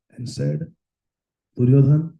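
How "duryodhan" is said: solemn call. Acted. Bhishma calls Duryodhan to show the arrows, loud and firm. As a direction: call his name out loud.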